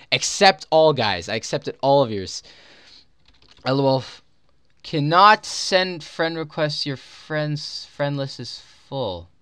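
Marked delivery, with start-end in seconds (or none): none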